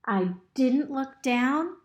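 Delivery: ordinary delivery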